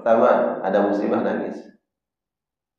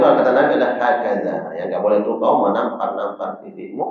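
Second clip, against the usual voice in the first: ordinary talk, nothing marked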